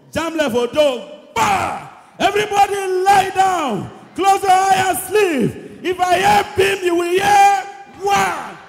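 loud, high barked orders; angry father. Retold or imitated. Father orders his kids to bed with loud shouts. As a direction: storm in like a strict father. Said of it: "My papa putting us to bed be like armed robbery".

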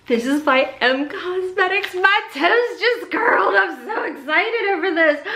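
high, breathless delight with a laugh; excited gasp. Real, not acted. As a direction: gasp and gush over the find. Unboxing, thrilled by M Cosmetics.

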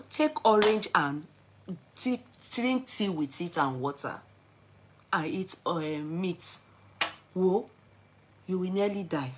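ordinary delivery

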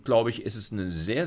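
usual speaking voice

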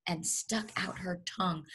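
hushed whispered narration. A narrator's reading. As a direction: breathe it out, building suspense. Spell cast, suspense.

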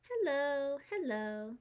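usual speaking voice

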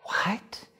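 breathless, high whispered disbelief; stunned whisper. Retold or imitated. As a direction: gasp it out in shock, barely voiced. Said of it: "And I was just like".

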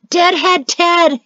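a high, loud, slow jeering shout; mocking shout at his departing brother; shouted taunt. A narrator's reading. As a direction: yell the nickname after him, drawn out. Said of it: "he shouted".

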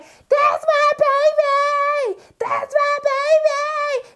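put-on high, slow, sung wail of that's my baby; wailing mother. A frantic mother bursting in to claim her baby, high slow wail. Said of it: "She drives into the people's house like".

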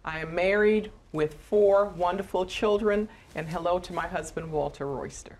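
ordinary delivery

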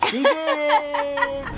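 excited cheer. Real, not acted. Cheering a win in the game.

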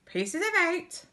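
high-pitched talking parrot; parrot call. A narrator's reading. Storyteller voicing other parrots' stock phrase, high parrot call. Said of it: "Other parrots could say".

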